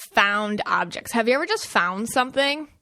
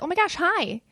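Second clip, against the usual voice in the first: happy greeting; 8 semitones higher than usual. Pretend. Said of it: "they want you to be like".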